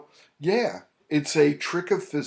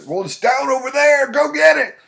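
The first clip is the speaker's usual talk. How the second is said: much higher and louder mock dare; teasing dare; 14 semitones higher, 11 dB louder than usual. Therapist daring his client to fetch the anxiety, loud teasing. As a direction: goad him playfully. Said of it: "I said".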